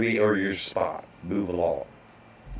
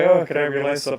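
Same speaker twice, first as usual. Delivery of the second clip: higher, sweeter mock clerk voice; bank lady; 7 semitones higher, 5 dB louder, faster than usual. Bank clerk asking for his license, chirpy and higher. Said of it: "she's like".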